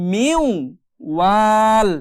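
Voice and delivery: "মিউ" held nasally into "ওয়াল"; merged ghunna. Shown as a demonstration. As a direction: blend a humming w from "মিউ" into "ওয়াল". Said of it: "এখানেও মিলিয়ে পড়ব".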